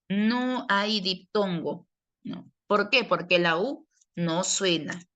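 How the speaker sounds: ordinary talk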